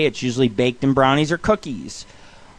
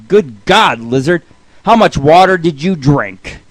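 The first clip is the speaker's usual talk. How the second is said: loud mock astonishment, drawn-out punchline; acted disbelief, slowing on the question; 7 dB louder, slower than usual. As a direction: exclaim it as a stunned cartoon monkey. Monkey's astonished punchline to a joke.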